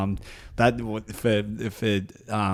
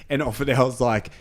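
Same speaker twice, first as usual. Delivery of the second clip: ordinary talk, nothing marked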